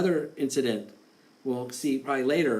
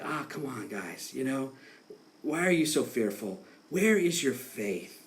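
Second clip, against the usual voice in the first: much softer, fond, reassuring nudge; gentle coaxing; 4 semitones lower, 5 dB quieter than usual. Retold or imitated. Jesus gently asking the fearful disciples where their faith is, softly. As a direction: coax them kindly out of their fear. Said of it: "No, he was basically like".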